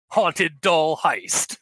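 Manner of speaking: slow, drawn-out title reveal; mock trailer announcer. Podcaster announcing a made-up horror film, mock trailer announcer. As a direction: stretch it out like a spoof trailer title.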